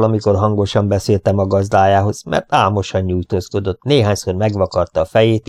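ordinary delivery, nothing marked

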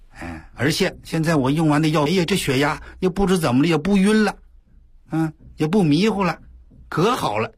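a retold old lady's delight, lightly voiced; relieved excitement. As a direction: gush about feeling better. Mother relieved, praising recovery.

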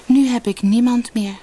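high, plaintive lament; unhappy. A narrator's reading. Sina realises she has nobody left, unhappy. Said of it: "dacht ze ongelukkig".

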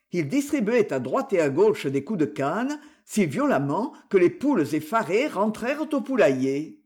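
ordinary delivery

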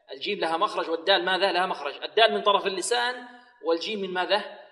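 usual speaking voice

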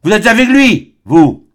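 loud, very high accusation; shouting. A narrator's reading. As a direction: shove him off and yell. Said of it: "le repoussa en criant". (crié, très fort et aigu)

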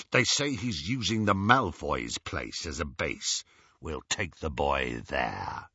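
low, gruff gang leader; Greyback. A narrator's reading. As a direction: settle the plan in a villain's deep voice.

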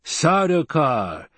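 slow, high, drawn-out musing; pondering aloud, stretched out. A narrator's reading. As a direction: savour the name slowly. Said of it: "the Fremen mused".

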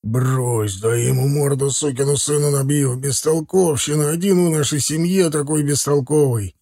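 lightly voiced gruff, angry brother; angry brother Zakhar. A narrator's reading. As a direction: grumble in rage, drawing out the insults.

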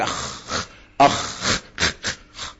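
soft, unvoiced scrape ending أخ; khaa demo. Shown as a demonstration. Teacher shows last throat letter, khaa demo. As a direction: rasp out the خ of أخ by itself. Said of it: "وبعدين آخر حاجة".

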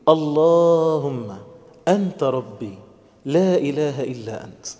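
slow prayer, phrase by phrase with pauses; gentle and unhurried. Shown as a demonstration. Preacher showing how to dwell on the meanings, gentle and unhurried. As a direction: draw out the first word, then pause after each phrase. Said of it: "عايز بس بالراحة كده أقف على شوية معاني".

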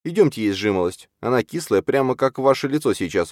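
high-pitched character voice; high, playful invitation; teasing heroine. A narrator's reading. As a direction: coax him in a light, high voice. Heroine teasingly invites him to eat.

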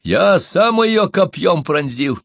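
high, slow boast. A narrator's reading. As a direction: brag loudly of the deed, drawing it out. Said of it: "хвастался Иктонка".

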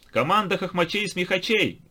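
high, loud announcer call; loud announcement. A narrator's reading. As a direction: call it out across the hall. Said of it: "громко объявил кто-то".

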